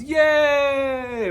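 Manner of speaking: high, loud shouted yay; the whole class shouting yay together; class cheer. Retold or imitated. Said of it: "the whole class goes".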